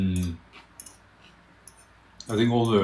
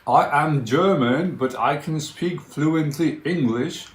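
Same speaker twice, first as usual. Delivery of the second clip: put-on slow, higher German accent meme; 5 semitones higher, slower than usual. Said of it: "They know the meme?".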